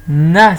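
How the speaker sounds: "nas" loud with a long hissed s; "nas" punched out with a lengthened ending; sounding out syllable. Shown as a demonstration. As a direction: hold the last sound of "nas": nasss. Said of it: "Qu'est-ce qu'on entend alors?".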